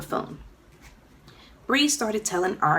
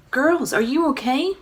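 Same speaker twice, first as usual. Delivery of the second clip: worried check; 6 semitones higher, 4 dB louder than usual. A narrator's reading. Mother checking after quake.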